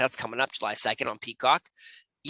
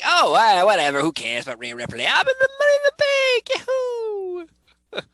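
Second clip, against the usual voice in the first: mock Liv Morgan; 6 dB louder than usual. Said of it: "Liv Morgan, who's now like".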